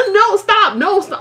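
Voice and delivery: high squealy mock protest; being tickled. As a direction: squeal no while laughing. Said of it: "if I'm like".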